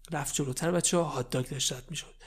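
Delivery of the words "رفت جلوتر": plain reading voice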